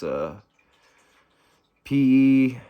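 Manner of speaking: usual speaking voice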